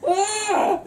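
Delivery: high-pitched yelp amid laughter; sudden high cry of shock; startled yelp. Real, not acted. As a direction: let out a high, laughing shriek. Reacting to co-host's outburst.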